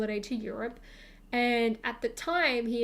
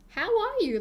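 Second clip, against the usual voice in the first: much higher, a bit louder how are you; awkward friendly question; 10 semitones higher, 4 dB louder than usual. Retold or imitated. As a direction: squeak it out, trying to sound casual. Nervously reaching out to old friend.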